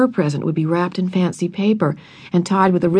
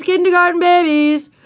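much higher, mocking sing-song chant; repeated taunting yell; 11 semitones higher than usual. A narrator's reading. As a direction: keep jeering as they run by. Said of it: "big first grade boys ran past yelling".

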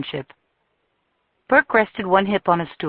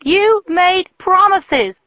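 much higher and louder, very slow; slow furious shout; 14 semitones higher, 8 dB louder, much slower than usual. A narrator's reading. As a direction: pound out each word. Jilted woman rages at Tucker.

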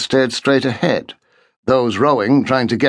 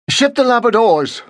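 high, croaking reluctant order; croaked order; 12 semitones higher than usual. A narrator's reading. Bernard gives in to Markham, croaking the order. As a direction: croak it out, beaten. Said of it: "Bernard croaked".

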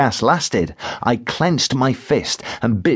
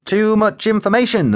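squeamish; 10 semitones higher than usual. A narrator's reading. Narrator's squeamish thought.

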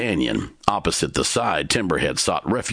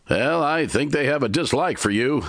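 Timber, dry; 11 semitones higher than usual. A narrator's reading. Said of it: "Timber stated".

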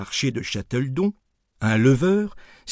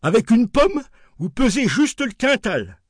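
higher, gleeful wholesaler voice; delighted dealer; 9 semitones higher than usual. Retold or imitated. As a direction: announce the weight with a grin. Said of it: "s'écria-t-il".

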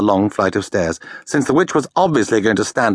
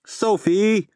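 drawn-out appeal of her name, mildly pleading; 11 semitones higher than usual. A narrator's reading. Howl pleading with Sophie for sympathy, a touch of whining. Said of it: "Howl pleaded".

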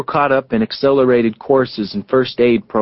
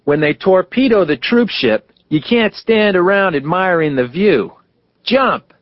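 shouted; 6 semitones higher than usual. A narrator's reading. Phineas urges Gene on the branch to jump, shouting. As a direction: urge him on from below with a yell. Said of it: "Finney shouted".

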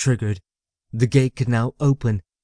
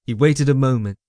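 usual speaking voice

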